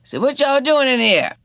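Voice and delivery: a tipsy man calling out a question; Cootie May asking. A narrator's reading.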